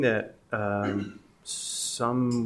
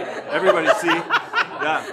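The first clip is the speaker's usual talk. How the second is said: laughing; 6 semitones higher, 5 dB louder than usual. Real, not acted. Laughing with audience.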